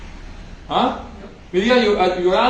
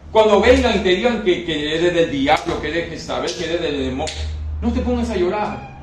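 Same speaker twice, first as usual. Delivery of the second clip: usual speaking voice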